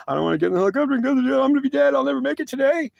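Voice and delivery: very high, rushed mock wail; high-pitched crying panic, words tumbling out. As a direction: act out a terrified, tearful protest. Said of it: "And I'm crying".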